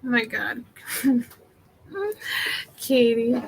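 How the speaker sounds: ordinary talk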